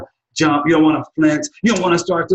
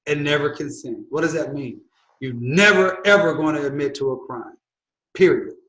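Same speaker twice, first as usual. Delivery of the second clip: ordinary talk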